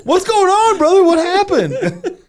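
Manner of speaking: very high, loud squeal; hammed-up high-pitched bewilderment; mock panicked reply. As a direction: shriek the question in comic alarm. Imagined panicked reply to text.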